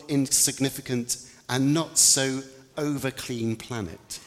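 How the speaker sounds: ordinary talk, nothing marked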